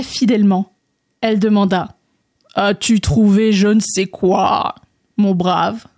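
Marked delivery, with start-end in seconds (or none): 2.5-5.9 slow frog with a drawn-out croak; frog character. Acted. Frog asking André about his quest, slow croaky drawl. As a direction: croak the question, stretching the vowels.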